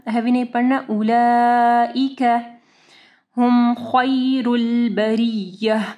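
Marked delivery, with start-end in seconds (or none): 0.9-2.4 slow उलाइका, long laa, light k at the end; soft kaf. Shown as a demonstration. Teacher corrects a heavy kaf for students, soft recitation. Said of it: "काफ को जो है नरमी के साथ पढ़ना है". 3.4-5.8 slow tajweed chant of हुम खैरुल बरिय्यह; thick kh and r in खैरुल, drawn-out बरिय्यह; heavy kha. Shown as a demonstration. Teacher models the verse for students, heavy letters. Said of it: "खा को हैवी पढ़ना है".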